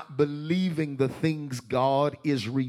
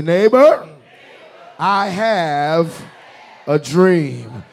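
higher, louder call to a neighbor; 7 semitones higher, 14 dB louder, much slower than usual. Shown as a demonstration. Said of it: "Find one more person and tell them".

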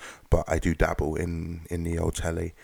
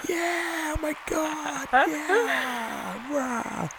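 high squeaky fake crowd cheer; falsetto mock cheer; much slower than usual.

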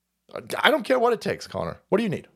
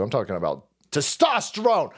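ordinary talk

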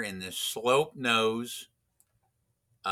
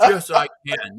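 higher, louder mock comeback; indignant retort; 4 semitones higher, 10 dB louder than usual. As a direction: imitate a student protesting, pitched up and loud. Student retorts when told he can't.

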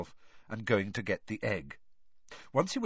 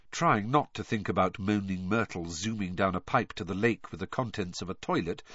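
ordinary delivery, nothing marked

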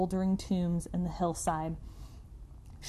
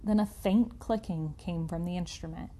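usual speaking voice

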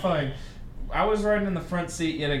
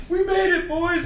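triumphant; 12 semitones higher than usual. Retold or imitated. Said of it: "So it was like".

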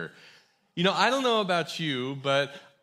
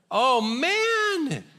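excited exclamation; 9 semitones higher, 4 dB louder than usual. Real, not acted. Reacting to a wild show.

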